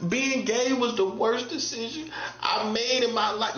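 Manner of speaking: retelling a counselor's tearful confession, near tears; imitating near tears. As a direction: mimic a man about to break down, slowly. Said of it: "He was almost like he was about to cry".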